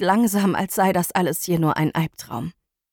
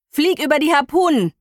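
raised, commanding shout over the engine; urgent order; 7 semitones higher, 3 dB louder than usual. Acted. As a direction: bark the order to fly over. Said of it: "ordnet Elvar an".

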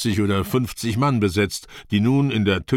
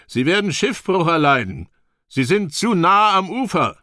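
much higher, urgent-sounding shout; calling out; 9 semitones higher, 3 dB louder than usual. A narrator's reading. Young warrior warning that ships near the shore will wreck, calling out. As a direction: call out a warning, pitch climbing high. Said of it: "rief mir Berg zu".

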